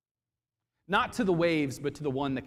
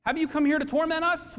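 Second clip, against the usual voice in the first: much higher, loud frightened cry; terrified; 13 semitones higher, 5 dB louder than usual. Shown as a demonstration. Demons terrified of Jesus, high frightened cry. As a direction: cry it out in terror. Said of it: "What does that sound like? Fear".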